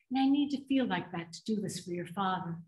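low, soft confession; hushed, sorrowful admission; subdued grief. Acted. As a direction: confide it quietly, with an ache. Confides missing her late husband.

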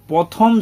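প্রথম said as "pothom", without the r; mispronounced first word. Retold or imitated. Pupil answering which class they are in, mispronounced. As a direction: say প্রথম with the r dropped after p. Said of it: "বেশিরভাগ ভুল করে".

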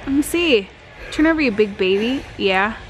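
normal talking voice

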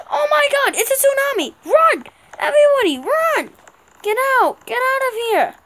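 high panicked yelling to flee; disaster movie extra. Pretend. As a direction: scream like a fleeing crowd extra. Tsunami appears, yelling to flee.

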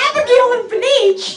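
high, loud disbelieving repeat; high mock outrage; incredulous echo. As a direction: squeal the name back high. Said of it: "And I'm like".

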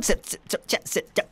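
urgent whispered sit commands; breathless whisper coaxing the baby to sit. Acted. Urging baby to sit quietly.

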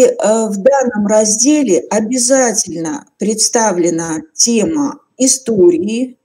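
ordinary talk, nothing marked

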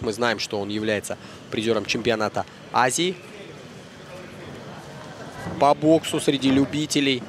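ordinary talk

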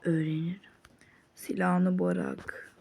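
slow unvoiced threat; menacing whisper. Ordering the rival to drop his gun, slow whisper.

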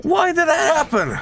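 high-pitched, baffled outcry; exasperated wail. Real, not acted. Gamer asks why the hull failed, wailing. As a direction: wail the question in disbelief.

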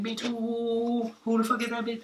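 high, slow shrieking girlfriend, mock outrage; mock angry girlfriend. He mimics his girl confronting him, high and shrieking. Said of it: "She run up on me like".

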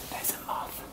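soft unvoiced whisper. Real, not acted. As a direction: whisper so nobody hears. Quiet in stairwell.

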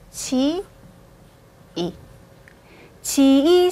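치 and 이 said apart; 치, 이 broken in two; wrong split syllables. Shown as a demonstration. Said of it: "이렇게 읽는 게 아니라". (치와 이를 따로 끊어서)

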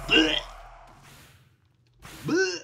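short, loud blurted retch; mock puking noise. Player mimicking the wither puking TNT, mock retch. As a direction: imitate spewing in a quick burst. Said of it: "Just pukes on him".

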